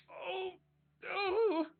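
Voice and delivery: high startled oh of pain; a kid who just bumped the table; hurt gasp. Acted. Little brother hits the table, pained gasp. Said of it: "trying to be".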